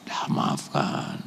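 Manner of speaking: slow whispered "dah maafkan"; whispered plea. Real, not acted. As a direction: breathe the advice softly. Menasihati dengan lembut. (bisikan lembut agar memaafkan)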